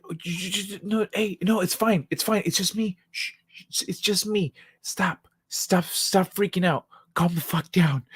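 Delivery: mock-panicked attempt to calm someone down; urgent high-pitched backpedaling, acted out; panicked hushing. Retold frantic soothing of scared brother.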